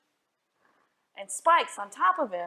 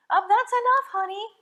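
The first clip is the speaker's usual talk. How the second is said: high, loud worried mom voice; anxious mother calling out; nervous mother; 11 semitones higher, 6 dB louder than usual. Acted. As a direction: mom getting worried, much higher. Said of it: "said Crispin's mother".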